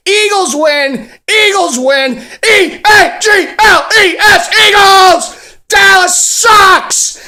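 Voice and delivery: high, loud victory yells; ecstatic shouting. Real, not acted. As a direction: scream it like your team just won. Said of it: "Can you tell I'm a little excited".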